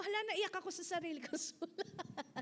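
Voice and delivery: amused, laughing through the words; a chuckle breaking into the line; laughing at herself. Retold or imitated. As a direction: laugh as you admit it. Laughs at crying over own letter.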